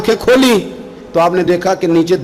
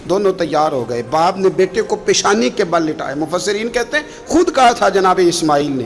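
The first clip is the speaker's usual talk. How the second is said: ordinary delivery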